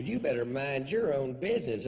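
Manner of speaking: mock loud retort. The woman snapping back.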